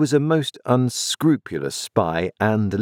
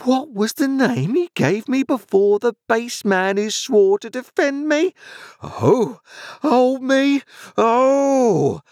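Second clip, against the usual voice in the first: very high melodramatic wailing; hysterical wife; slower than usual. Acted. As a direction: screech in outrage, building to a wail. Sister works herself into hysterics.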